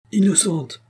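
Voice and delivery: high called-out cry; shouted verdict. Acted. Tribunal man calls out the verdict to the crowd, high cry. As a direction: call it out across the court. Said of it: "cria l'homme".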